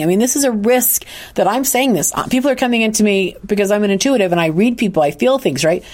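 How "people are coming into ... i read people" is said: ordinary talk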